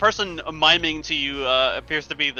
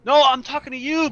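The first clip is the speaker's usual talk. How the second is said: high loud yell; yelling at camera; 12 semitones higher, 6 dB louder than usual. Pretend. Hacker yelling into the camera at the viewer, high loud yell. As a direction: shout right into the lens. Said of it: "yelling into the camera at you".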